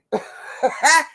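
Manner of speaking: bright burst of laughter; real laugh at the joke; high-pitched laugh. Real, not acted.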